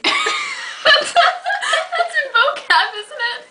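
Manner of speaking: high squeaky laughter through words; breaking up laughing. Real, not acted. Cracking up mid-rehearsal.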